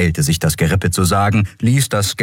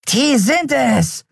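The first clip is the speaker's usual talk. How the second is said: very high, slow, eerie bony creature; bizarre skeleton voice; slower than usual. Acted. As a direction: announce it like a grinning ghoul pointing a finger. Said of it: "verkündete seine bizarre Stimme".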